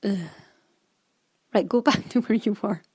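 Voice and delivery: quick, fed-up mutter to herself with a laugh; brisk, resigned self-instruction; exasperated self-talk. Retold or imitated. Knitter telling herself to redo the stitches, exasperated. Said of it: "and I thought".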